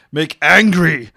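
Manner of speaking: slow, mock-furious shout; angry outburst. Reader demonstrating a violent verbal outburst, angry shout. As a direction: burst out loudly on the word angry. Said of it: "Develop sudden and violent verbal outbursts".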